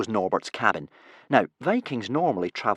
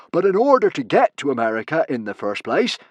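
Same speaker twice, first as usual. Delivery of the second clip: Norbert; 6 semitones higher, 5 dB louder than usual. Acted. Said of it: "Norbert continued".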